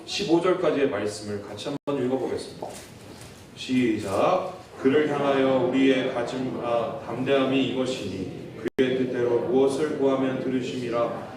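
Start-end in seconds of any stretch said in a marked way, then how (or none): 4.8-11.3 scripture reading. Acted.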